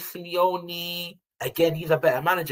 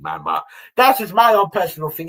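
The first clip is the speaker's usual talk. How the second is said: normal talking voice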